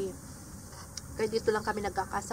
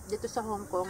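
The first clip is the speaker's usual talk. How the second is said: usual speaking voice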